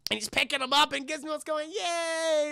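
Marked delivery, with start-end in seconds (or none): 1.7-2.5 high little squeaky cheer; pitched-up, drawn-out glee; cute creature cheer. Retold or imitated. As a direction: imitate a tiny happy pet. Gizmo cheers when picked up.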